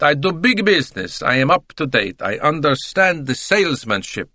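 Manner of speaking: put-on high, lilting Italian accent; punchy, self-satisfied Italian; boastful Italian salesman. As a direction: proudly sell yourself in an Italian accent.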